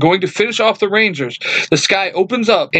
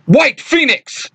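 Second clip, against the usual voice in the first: yell; 11 semitones higher, 3 dB louder, slower than usual. A narrator's reading. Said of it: "Tommy yells".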